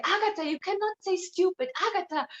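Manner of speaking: lightly mock his nagging tone; imitating a lecturing boyfriend. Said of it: "and he's like".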